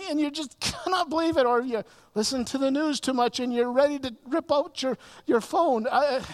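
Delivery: mock rising fury about kids, work and news; building exasperation. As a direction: work up the outburst. Mimicking exasperated anger.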